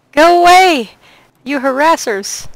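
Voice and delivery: loud, high shout; yelling. Real, not acted. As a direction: yell them away. Yelling at chasing dragonflies.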